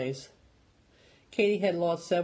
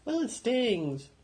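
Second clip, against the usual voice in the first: high, slow sulky voice; sulky boy; 9 semitones higher, slower than usual. A narrator's reading. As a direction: drag it out, sulking.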